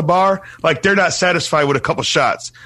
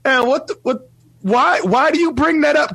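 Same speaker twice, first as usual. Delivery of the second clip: high, baffled why; mock disbelief at a question; pitched-up bewildered quote; incredulous; 11 semitones higher than usual.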